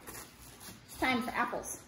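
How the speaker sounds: ordinary talk, nothing marked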